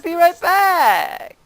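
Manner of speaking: high, drawn-out "be right back"; mock teammate call. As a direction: stretch it out loud and high, teasing. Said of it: "you just said".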